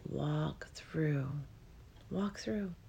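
soft whisper. Acted. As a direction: whisper it, slow and hushed. Urging listener through the doorway.

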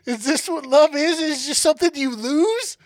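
high, loud melodramatic lament; hammed-up tragic questioning; mock heartbreak. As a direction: plead to the heavens in mock grief. Mock lament over squished tadpoles.

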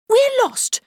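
put-on very high, loud little girl; plaintive. Lost girl to the stranger, high and plaintive. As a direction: cry it out to the stranger.

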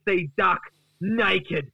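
high, grandly put-on announcement; defiant Duck. Duck promises Gary he will redeem himself, defiant boast. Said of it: "And Duck says".

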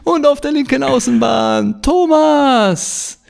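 drawn-out stadium announcer call. Pretend. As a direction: call the player's first name like a stadium announcer. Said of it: "im Stadionsprechermanier".

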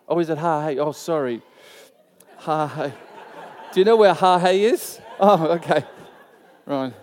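ordinary talk

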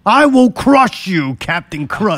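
loud, slow, high menacing threat; cold rasping evil emperor. Retold or imitated. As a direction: growl it like a cartoon villain. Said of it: "like Captain Kurt".

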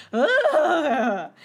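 a snorting whinny of a laugh; horse neigh laugh. Real, not acted. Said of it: "That was a horse neigh".

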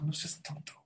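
whispered aside, breathy and hushed; a friend whispering advice. Retold or imitated. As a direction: hiss it to the friend under the breath.

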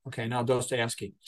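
usual speaking voice

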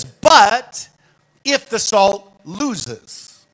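BUT if the salt loses, drawn out slowly; stressed slow reading. Shown as a demonstration. Preacher showing his congregation how Jesus said it, slow and emphatic. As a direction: lean hard on BUT, then slow the rest right down. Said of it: "but he says it like this".

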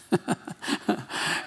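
short awkward breathy laugh; nervous laugh. Real, not acted. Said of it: "that's my awkward laugh".

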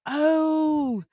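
high, loud, yearning inner voice; eager wish. Retold or imitated. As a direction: gush with longing to be better. Her inner wish quoted.

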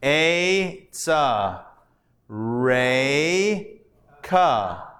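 syllable by syllable, each vowel held, with gaps; slow sounding-out. Shown as a demonstration. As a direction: sound out "A, sa. Re, ka." slowly for learners. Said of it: "now we're blending again. Hold on".

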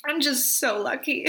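real tearful, high voice; overcome, voice pushed up high by tears. Real, not acted. Podcaster moved by the people around her, tearful. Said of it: "I feel like I have to cry".

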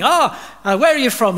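high, loud sing-song call; imitated market trader calling out; souk shopkeeper. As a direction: hail a passing buyer from the doorway.

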